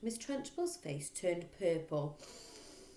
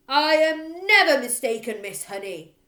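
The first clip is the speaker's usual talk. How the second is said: very loud higher shout; furious bellow; 8 semitones higher, 16 dB louder than usual. Acted. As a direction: blast it at her. Trunchbull voice.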